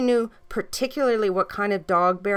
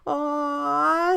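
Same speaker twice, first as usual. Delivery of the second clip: higher, lightly voiced little girls cooing; little girls melting over cute puppies, a high aw; 8 semitones higher than usual. A narrator's reading. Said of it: "said the little girls".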